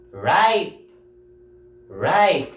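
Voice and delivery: "ripe" said emphatically with a long "eye" vowel; long I sound. Shown as a demonstration. Teacher modelling the next word, emphatic long I. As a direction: model "Ripe" twice with a full "eye" glide. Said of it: "you have to pronounce it as I".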